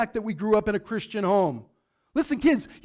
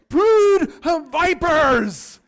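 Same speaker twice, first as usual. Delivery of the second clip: roared, slow and much louder; booming slow denunciation; shouted; 8 semitones higher, 10 dB louder, much slower than usual. Shown as a demonstration. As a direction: bellow it like a fire-and-brimstone preacher. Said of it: "if I did that".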